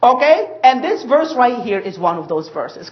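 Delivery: ordinary delivery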